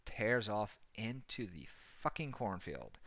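ordinary talk, nothing marked

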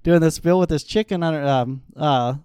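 ordinary talk

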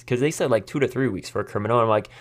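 ordinary delivery

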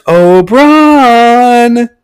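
high, drawn-out singsong call; calling temptress voice. Retold or imitated. Mystery woman calling out to Braun, singsong calling. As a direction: coo the name in a put-on high voice. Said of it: "Then Braun hears".

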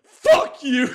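high, loud snapped curse; hostile outburst. Retold or imitated. Comic showing how his stage breakdowns go, loud. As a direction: spit it at the crowd. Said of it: "it's more just been like".

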